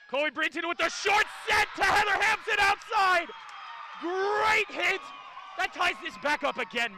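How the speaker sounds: high, shouted sports call; too excited. Real, not acted. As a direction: shout the big hit, carried away. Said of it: "I'm getting too excited".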